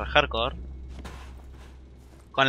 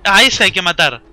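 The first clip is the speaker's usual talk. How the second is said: urgent shout; 4 semitones higher, 8 dB louder than usual. Real, not acted. Spotting big zombie, urging kill.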